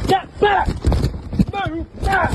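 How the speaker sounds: shouting. Real, not acted. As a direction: bark the orders at full volume. Bailiff orders man back.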